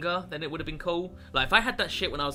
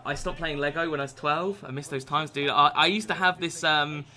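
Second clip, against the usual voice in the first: ordinary talk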